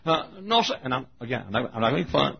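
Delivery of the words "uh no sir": higher Southern dialect voice; imitated Southern deacon. Deacon answering whether he is pastor, high Southern dialect. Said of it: "This is the way all of us Southerners talk".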